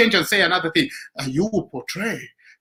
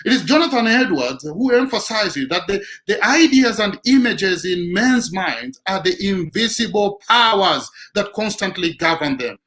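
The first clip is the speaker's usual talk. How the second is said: ordinary talk, nothing marked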